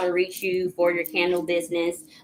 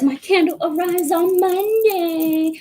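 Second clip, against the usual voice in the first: excited reading; 11 semitones higher, 4 dB louder, slower than usual. Retold or imitated. Reading excited viewer comment.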